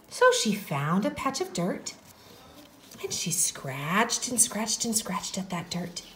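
soft, hushed storytelling; story narration. A narrator's reading. Teacher opens the Little Red Hen story, soft narration. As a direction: tell it gently to little ones.

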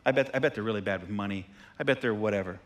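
judgmental guessing. Retold or imitated. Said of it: "We just guess".

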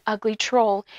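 usual speaking voice

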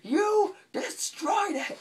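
very high, loud, drawn-out accusation; hammed-up cartoon outrage, slow and piercingly high; funny exaggerated yell. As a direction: stretch each word in a cartoonish shriek. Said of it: "says it in a really funny way".

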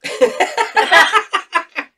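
high, loud cackle of delight; loud burst of laughter. Real, not acted. Laughing at Johanna eating the generic by mistake, loud laugh. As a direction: crack up at her mistake. Partner ate the wrong one.

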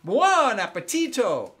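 slow, loud Italian "Buon appetito" with a flourish; Italian exclamation. Shown as a demonstration. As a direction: announce the meal like an Italian host. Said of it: "open up your arms like this".

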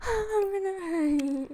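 high, drawn-out overwhelmed whine; about to cry. Real, not acted. Gamer spotting a cat like hers, high tearful wail. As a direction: wail it slowly, overcome with excitement. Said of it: "I've said I'm gonna cry too many times".

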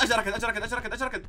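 2 high, loud, laughing as he flees; 3 blurt it out half laughing in a panic; 4 a gamer fleeing in the game, laughing. Real, not acted. Laughing panic, someone coming.

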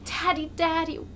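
put-on a little boy crying for his father; child shouting. A narrator's reading. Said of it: "he shouts to his father".

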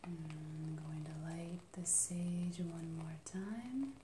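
ordinary talk

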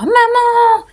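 high loud call; boy yelling again for mum; child shouting. A narrator's reading. A boy among flamingos shouts for his mum. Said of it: "Le petit garçon crie encore".

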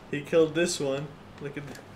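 ordinary talk, nothing marked

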